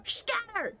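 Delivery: high-pitched cry to scatter; mock alarm call. Player joking about people fleeing, high mock alarm call. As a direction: send everyone running, playfully.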